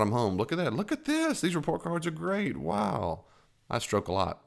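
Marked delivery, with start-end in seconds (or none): 0.4-3.3 high, soaring wows over report cards; delighted praise. Retold or imitated. Dad admiring his kids' report cards, high and delighted. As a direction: gush like a thrilled parent. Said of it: "report cards, brought 'em home".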